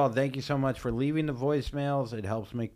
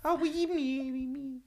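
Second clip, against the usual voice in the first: mocking whine; 4 dB quieter than usual. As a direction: whimper the proposal mockingly. Mocking the voicemail proposal.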